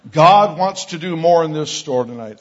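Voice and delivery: loud public-address call to shoppers; intercom announcement. Retold or imitated. Young man back on the store mic, announcement voice. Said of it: "he gets the mic back and he said".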